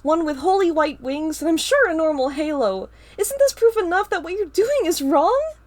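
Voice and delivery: outraged accusation. Acted. Star confronts Ava, outraged.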